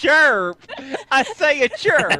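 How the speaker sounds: high, laughing southern drawl; southern accent. Acted. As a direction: chirp like a hammy southern orator. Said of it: "With a southern accent".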